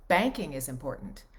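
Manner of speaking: BANKING hit first, the rest falling away; stress on BANKING. Shown as a demonstration. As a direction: punch the word Banking. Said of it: "emphasis on certain syllables and things".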